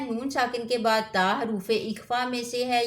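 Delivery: normal talking voice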